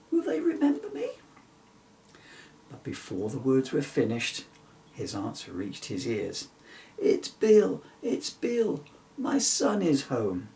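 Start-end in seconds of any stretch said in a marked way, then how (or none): 0.1-1.1 anxious question. Acted. Bill worrying about home. 7.0-10.5 tearful mother. Acted. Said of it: "said a mother's voice through tears".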